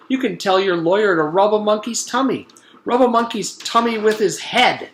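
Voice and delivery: loud, high furious rant; angry yelling. A narrator's reading. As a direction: tear into the caller. Dentist yelling on the phone.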